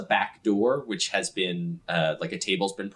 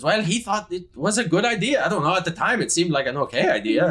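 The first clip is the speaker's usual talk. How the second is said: raised, sheepish excuse that sinks; 10 semitones higher, 3 dB louder than usual. Acted. Sky excusing herself to Yuri, sheepish. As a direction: shrug it off weakly.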